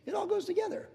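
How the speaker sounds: very high, squeaky mock-fretting; acted worry. Preacher mimics words that spill from worry, high mock-panic. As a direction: imitate a worrier in a very high voice. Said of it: "our words just come out like".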